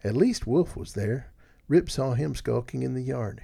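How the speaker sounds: ordinary delivery, nothing marked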